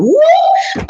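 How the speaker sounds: sudden high joyful shout; a burst of glee at the news; excited whoop. Retold or imitated. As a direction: whoop with delight. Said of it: "and I thought".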